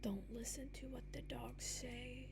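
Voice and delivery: horror-film whispered warning; creepy whisper. Pretend. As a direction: warn them in a spooky hush. Creepy warning about dogs.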